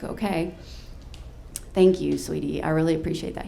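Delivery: low, subdued retold thanks; understated tearful gratitude; touched, near tears. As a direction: thank him quietly, moved. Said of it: "I started to tear up".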